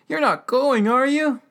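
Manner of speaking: high, loud pleading question; imitated old man's dismay; alarmed old man. Mister Reams alarmed they are leaving.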